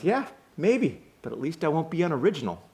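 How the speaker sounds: high-pitched comeback; acted retort. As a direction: toss back the last word.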